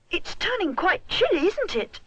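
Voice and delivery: put-on high, boyish little bear voice; young bear voice. A narrator's reading. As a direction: lift into a small boy's voice. Rupert's voice, very high.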